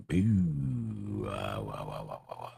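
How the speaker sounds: low fading sad trombone wah; mock sad wah wah.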